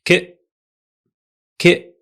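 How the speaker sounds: a crisp lone "k", short and clipped; isolated k sound. Shown as a demonstration. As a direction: say "k" alone, twice, slowly. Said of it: "this letter gives the sound".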